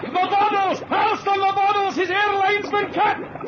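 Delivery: high, frantic yelling for help; panicked shouting. Acted. Mac yelling to Alston to haul the diver up, frantic. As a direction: shout in desperate panic to save him. Partner panics as line is cut.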